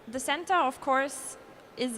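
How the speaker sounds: usual speaking voice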